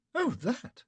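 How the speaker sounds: lightly voiced lady. A narrator's reading. Betty dismissive.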